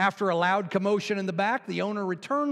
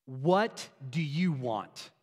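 very slow, lowered, exasperated question; fed-up lady; 4 semitones lower, much slower than usual. Acted. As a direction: draw out each word, lightly acted annoyance. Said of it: "She turns around and says".

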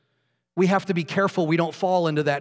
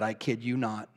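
ordinary delivery

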